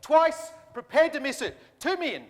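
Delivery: slow, loud, building shout; auctioneer's final call. Real, not acted. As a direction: bellow the last call. Auctioneer closing the lot.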